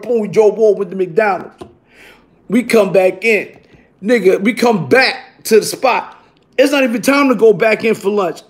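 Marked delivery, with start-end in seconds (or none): none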